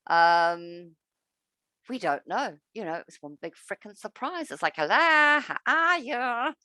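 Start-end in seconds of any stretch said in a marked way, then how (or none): 4.8-6.5 slow, drawn-out put-on hello; mock greeting. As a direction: stretch it out like a fake polite greeting.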